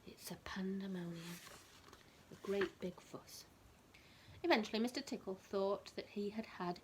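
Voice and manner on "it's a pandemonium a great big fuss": whispering. Real, not acted. Whispered explanation to child.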